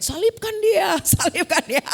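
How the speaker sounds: high, loud crowd shout breaking into laughter; hammed-up condemning chant, half laughing; mock mob cry. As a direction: playfully yell like a baying mob. Mock crucifixion mob, then laughing.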